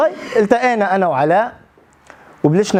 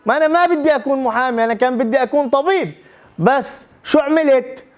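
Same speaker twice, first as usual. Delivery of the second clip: higher-pitched, exasperated venting; retold frustrated protest; 5 semitones higher than usual. Lawyer who wanted medicine venting to interviewer, retold frustrated protest. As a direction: act out a friend protesting his lot.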